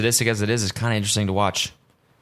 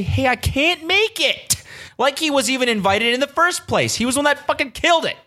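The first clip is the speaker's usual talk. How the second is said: very high-pitched, incredulous indignation; mock the excuse, then protest in disbelief; outraged rant. Podcaster fuming at the friend who killed Taco Tuesday, outraged.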